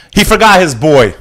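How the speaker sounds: loud, high, aggrieved call-out; indignant. Real, not acted. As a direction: throw the complaint out hard. Indignant rant about being forgotten.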